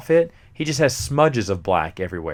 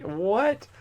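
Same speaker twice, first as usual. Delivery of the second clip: ordinary delivery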